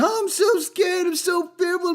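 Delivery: high, sarcastic mock fright; hammed-up frightened whimper mocking them; mock scared. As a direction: sneer at their fake fear in a squeaky voice. Mocking people who claim fear.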